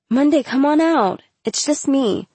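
high-pitched, urgent coaxing; coaxing plea. A narrator's reading. Girl coaxing her friend out of the stall, pleading. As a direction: plead softheartedly through a shut door.

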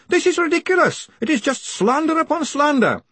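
very high-pitched indignant shouting; outraged shouting. A narrator's reading. Greenyham calls the charges slander, outraged shout. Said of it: "Greenyham shouted".

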